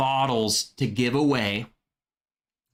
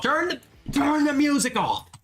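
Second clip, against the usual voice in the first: urgent; 12 semitones higher than usual. Real, not acted. Urging music off, higher.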